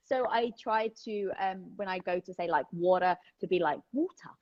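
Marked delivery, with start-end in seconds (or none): 3.9-4.3 British accent. Shown as a demonstration. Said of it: "I say in the British accent".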